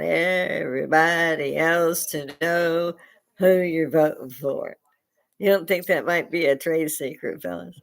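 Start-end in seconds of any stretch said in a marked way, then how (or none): 0.0-4.8 slow, drawn-out words in exasperation; exasperated drawl. Real, not acted. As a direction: stretch each word to make the point. Exasperated question with stretched words.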